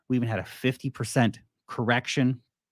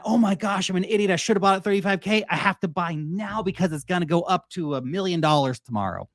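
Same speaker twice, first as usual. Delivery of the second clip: panicked FOMO buyer; 6 semitones higher than usual. Retold or imitated. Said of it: "the lizard brain comes back on".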